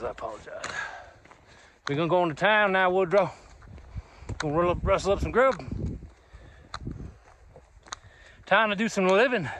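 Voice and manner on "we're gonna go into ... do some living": Gus from Lonesome Dove. Pretend. Hiker telling Woodrow they're heading to town, cowboy impression. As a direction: sign off as a Western cowboy. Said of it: "I've been talking like that".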